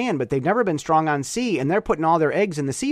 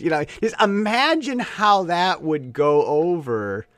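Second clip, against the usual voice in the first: normal talking voice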